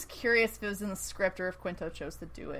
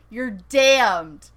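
loud mock condemnation; acted condemnation; 10 dB louder than usual. Podcaster re-enacting mother disowning her son, loud condemnation. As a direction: imitate a mother damning her son, loudly.

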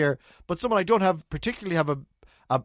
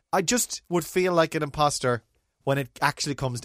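ordinary delivery

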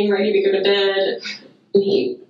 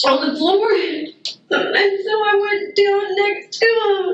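ordinary talk

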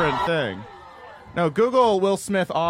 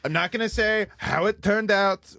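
higher-pitched mock-modest politician; coy boasting impression, pitched up; Trump; 3 semitones higher than usual. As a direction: humblebrag in a comic statement voice. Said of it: "Did you guys see his one today".

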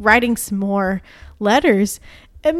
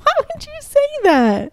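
mock wail. Host teased as problematic, high mock wailing. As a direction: whine it in a tiny high voice.

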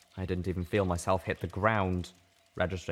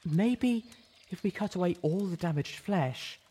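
hesitant offer; 10 semitones higher than usual. Acted. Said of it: "She offered".

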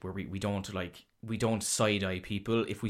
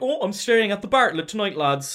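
cheery mock lad; 10 semitones higher, 7 dB louder than usual. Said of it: "he's gonna be like".

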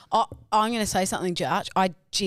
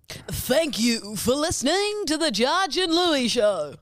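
announcer voice; 6 semitones higher, slower than usual. Acted. Podcast outro announcement.

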